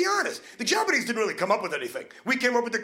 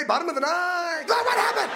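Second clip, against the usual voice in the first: lightly hammed-up eager friend demanding the news; mock breathless sports chat; 7 semitones higher than usual. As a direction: play both friends, one dragging it out, one begging. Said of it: "What if we talk like that".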